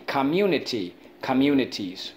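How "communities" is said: ordinary talk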